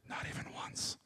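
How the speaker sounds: hushed, sinister whisper; voiceless, menacing hiss of a whisper; creepy whisper. Acted. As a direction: whisper it like a scary warning. Meth version's line in ad.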